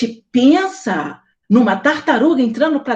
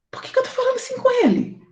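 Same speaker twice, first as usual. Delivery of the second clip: incredulous outrage; 14 semitones higher, much faster than usual. Retold or imitated. Retells her indignant reaction.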